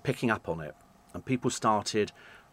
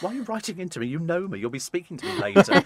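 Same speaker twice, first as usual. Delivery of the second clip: higher-pitched, baffled retort to a friend; mock exasperation; 7 semitones higher than usual. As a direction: playfully challenge a friend, pitch raised.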